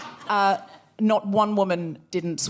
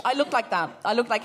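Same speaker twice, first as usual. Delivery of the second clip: usual speaking voice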